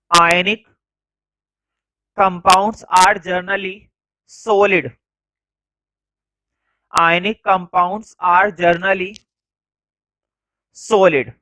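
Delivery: word by word, very slow, with long pauses; slow classroom dictation. Shown as a demonstration. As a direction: dictate each word so the class can write it down. Teacher dictating notes slowly.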